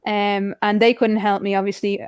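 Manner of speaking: normal talking voice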